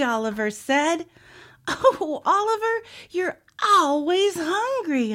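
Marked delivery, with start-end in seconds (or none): 1.7-5.1 high, drawn-out mock exasperation; sighing. A narrator's reading. Olivia to ever-hungry Oliver, sighing exasperation. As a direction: sigh it out, stretching "always". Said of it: "Olivia sighed".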